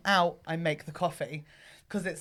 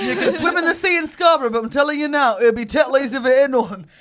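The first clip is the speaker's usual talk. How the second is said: put-on loud, higher-pitched stab at a Yorkshire accent; hammed-up Yorkshire accent; attempted Yorkshire accent; 4 semitones higher, 9 dB louder than usual. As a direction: have a noisy go at Yorkshire, not quite landing it. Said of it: "so close to a sentence and indeed an accent".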